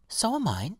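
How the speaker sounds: high-pitched, lightly voiced violet; agreeing violet. A narrator's reading. Violet answers the grumbling pansy.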